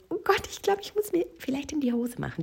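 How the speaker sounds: put-on very high, panicky child voice; scared little girl. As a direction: put on a small girl fretting. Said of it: "Dieses kleine Mädchen".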